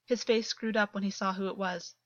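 usual speaking voice

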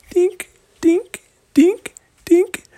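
tiny high-pitched dinks; little squeaky-high footstep sounds, spaced out; tiptoe dinks. Acted. Storyteller making tiptoe steps into the cage, high dinks.

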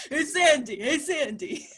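laughing movie-star impression; hammed-up imitation, laughing; John Travolta. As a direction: repeat the goofy celebrity call while giggling. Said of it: "sound like John Travolta".

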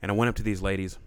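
ordinary talk, nothing marked

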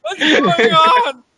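very high, loud, slow, wailing shriek; shrieking clerk in pain. Acted. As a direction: wail like a clerk just punched in the eye. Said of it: "You're like".

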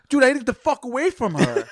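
high, loud outburst through laughter; worked-up exclamation. Real, not acted. Telling friends of his escape from the woman, worked-up. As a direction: burst out, half laughing, in disgust.

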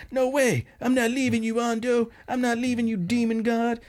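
high-pitched devoted underling, hammed up; mock heroic loyalty. Loyal gang member refuses to leave his boss, mock heroic loyalty. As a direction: refuse in a high, melodramatic henchman voice.

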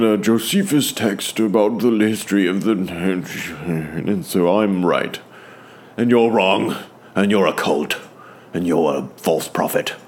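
mock English-accented scholar, starting high and easing down. Preacher mimicking seminarians picking the Bible apart, English accent. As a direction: put on an English accent as a pompous know-it-all. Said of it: "speaking in an English accent".

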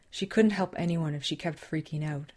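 ordinary delivery

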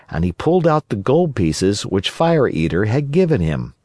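plain reading voice

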